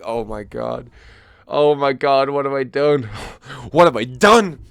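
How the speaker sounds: loud, rising horror; hammed-up dismay; mock panic. Groom at his own wedding, mock-horrified and loud.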